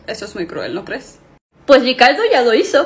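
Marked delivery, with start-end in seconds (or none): none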